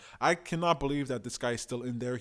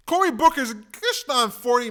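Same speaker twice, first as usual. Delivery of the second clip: mocking; 10 semitones higher, 10 dB louder than usual. Real, not acted. Mocking Booker on screen.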